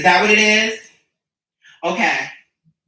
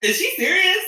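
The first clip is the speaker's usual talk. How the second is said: incredulous; 10 semitones higher than usual. Retold or imitated. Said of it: "I'm like".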